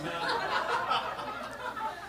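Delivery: high breathy laugh. Real, not acted. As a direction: laugh at the silly mistake. Laughing at own blunder.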